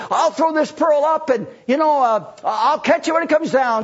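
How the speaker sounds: a cocky performer working the crowd; boastful showman. Acted. Showman promising trick.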